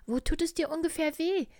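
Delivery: a kindly little bear voice, high and loud. Acted. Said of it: "fragte der kleine Bär".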